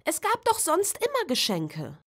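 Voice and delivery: put-on a little girl whining about missing presents; whiny child. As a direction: pout it out like a disappointed child.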